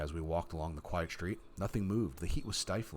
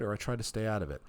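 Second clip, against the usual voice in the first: normal talking voice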